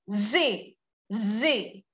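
ordinary talk

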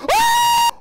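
high, loud scream of fright; real startled scream. Real, not acted. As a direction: scream as the clown jumps out. Startled by clown in horror game.

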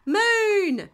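very high, loud drawn-out cry; high storybook yell; excited shout. A narrator's reading. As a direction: shout it out like an eager child. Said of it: "shouted Pedro Pony".

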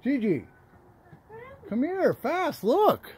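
loud urgent call across the house; calling from far away. Real, not acted. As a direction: shout for her to come quick. Calling someone over to see.

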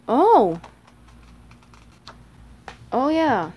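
usual speaking voice

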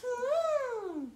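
high hummed trumpet call; elephant trumpet. Shown as a demonstration. Teacher showing elephant noise, high hum. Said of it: "Do that with our arm for our trunk".

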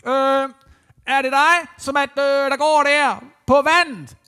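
high, loud call across the lake; calling out across water. Acted. Peter calls from the boat to Jesus on the water, loud. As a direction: shout it far out over the waves. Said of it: "Han råber lige ud over vandet".

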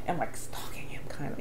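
hushed, sheepish whisper. Real, not acted. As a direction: let it slip under your breath. Confessing a slightly embarrassing habit.

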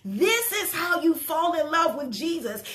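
normal talking voice

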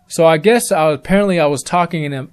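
ordinary delivery, nothing marked

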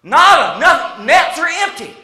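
loud, high punched-out words; emphatic acted frustration; exasperated answer. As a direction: declare utter failure at full volume. Answering with total failure.

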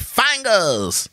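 high called-out catchphrase; playful high call; mock call. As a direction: call it out high to get the reply. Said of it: "I'd be like".

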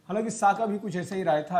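normal talking voice

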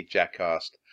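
ordinary delivery, nothing marked

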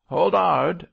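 high, loud interjection; eager rough shout; sudden cry. A narrator's reading. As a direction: cry out to cut him short. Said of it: "cried Mosk".

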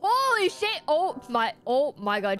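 normal talking voice

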